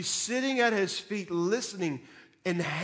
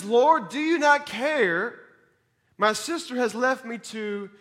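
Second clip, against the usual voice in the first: exasperated Martha; 5 semitones higher, 5 dB louder than usual. Acted. Quoting Martha again.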